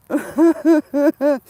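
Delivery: loud, high rolling giggles; giggling fit. Real, not acted. Laughing fit.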